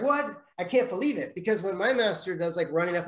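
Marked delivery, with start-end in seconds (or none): 0.0-1.3 amazed disbelief. Retold or imitated. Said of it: "he was like".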